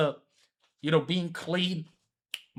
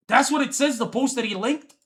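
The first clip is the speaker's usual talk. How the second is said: sudden burst, higher and louder; excited; 8 semitones higher, 6 dB louder than usual. Real, not acted. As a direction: exclaim it in disbelief. Reacting to a post in disbelief.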